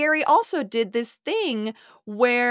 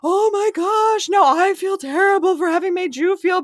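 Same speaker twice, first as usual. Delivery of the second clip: a syrupy, self-pitying whine, pitched way up; mocking Teresa's high whine; 11 semitones higher, 4 dB louder than usual. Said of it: "Teresa goes into the".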